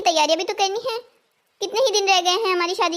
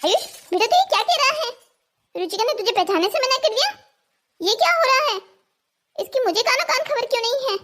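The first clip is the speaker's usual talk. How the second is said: put-on an old granny startled, then ready to march in; alarmed grandmother.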